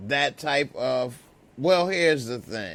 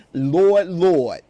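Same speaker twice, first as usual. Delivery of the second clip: loud New York exclamation; New York accent; 3 semitones higher, 9 dB louder than usual. Acted. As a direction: belt it out like a New Yorker. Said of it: "She from the N Y C".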